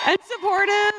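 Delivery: laughing shout. Real, not acted. Host thanking loud crowd.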